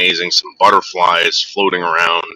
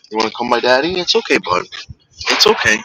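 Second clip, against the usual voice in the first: much higher cooing comfort; high sing-song reassurance for the dog; 4 semitones higher than usual. Real, not acted. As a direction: coax the dog over sweetly. Coaxing scared dog.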